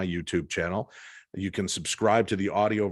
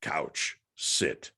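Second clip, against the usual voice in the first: low, word-by-word device imitation; electronic voice box; 7 semitones lower, much slower than usual. Ghost gadget answering its owner, low electronic voice-box impression. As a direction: drop low and give each word separately like a gadget. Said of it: "an electronic voice box".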